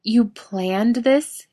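high, slow, stunned disbelief; bewildered. A narrator's reading. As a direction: stammer it out in shock. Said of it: "Misha gets out".

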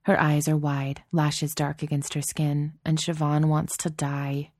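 plain reading voice

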